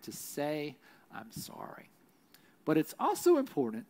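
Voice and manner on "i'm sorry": a soft, voiceless "I'm sorry"; whispered apology. Shown as a demonstration. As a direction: let it fall to a humble whisper. Models saying sorry humbly.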